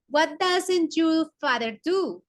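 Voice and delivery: "what doesn't your father do?" drawn out slowly; slow teacher's model of the question; slow English model. Shown as a demonstration.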